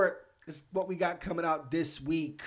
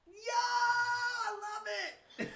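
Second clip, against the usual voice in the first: excited; much slower than usual. Real, not acted. Delighted by unanimous score.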